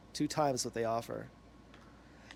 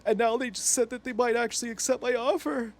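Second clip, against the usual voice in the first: high, mock-tearful imitation; pitched-up tearful complaint, hammed up; almost crying; 8 semitones higher than usual. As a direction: mimic a man about to burst into tears. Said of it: "gets really serious and he's almost crying".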